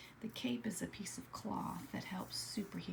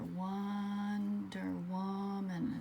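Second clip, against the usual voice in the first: stretched out slowly; much slower than usual. Shown as a demonstration. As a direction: Wonder Woman drawn out very slowly. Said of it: "kinda sounds like the other in the word wonder".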